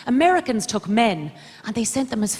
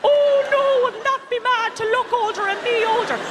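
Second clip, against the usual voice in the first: very high sarcastic squeal of alarm; mock horror; 3 dB louder than usual. Comedian mocking fear of looking older, shrieky sarcasm. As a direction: squeal it in exaggerated false panic.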